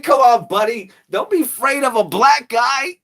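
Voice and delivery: mock street hustler coaxing tourists; hustler sales pitch. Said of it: "He said".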